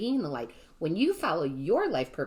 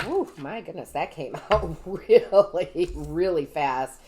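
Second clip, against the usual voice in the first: laughing surprise; 6 dB louder, slower than usual. Real, not acted. Card jumps out unexpectedly.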